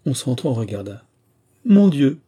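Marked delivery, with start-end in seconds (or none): 1.6-2.2 loud, high-pitched cry; exclaiming. A narrator's reading. Lyudmila seeing the drenched men, exclaiming loudly. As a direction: burst out, much louder and higher. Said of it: "s'écria Lyudmila".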